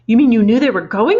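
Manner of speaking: loud, shocked accusing cry; cried out. A narrator's reading. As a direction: demand to know in dismay. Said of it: "Matilda cried".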